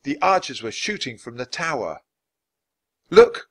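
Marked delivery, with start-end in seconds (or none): 3.1-3.5 high loud look; startled shout; crying out. Acted. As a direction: shout the discovery. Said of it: "cried Jack".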